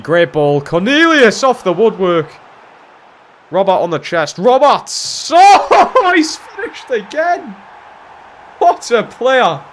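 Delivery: high, loud, elated commentary yell; excited goal shout. Real, not acted. Player celebrating Robert's goal, excited shouting. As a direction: erupt as the shot goes in.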